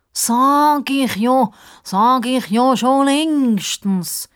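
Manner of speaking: high, slow, puffed-up voice; self-important. A narrator's reading. Sidekick claiming he always said so, self-important. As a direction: stretch it out importantly. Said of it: "flüstert der Justus wichtig".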